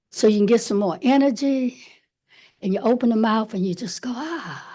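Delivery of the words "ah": long breathy release sigh; dramatic sigh. Shown as a demonstration. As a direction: let it all out in one sigh. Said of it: "Like a big sigh".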